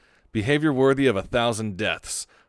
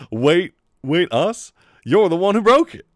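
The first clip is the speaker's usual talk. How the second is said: loud, rising outraged protest; a loud indignant comeback; indignant retort; 6 semitones higher, 9 dB louder than usual. Acted. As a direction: throw the blame back.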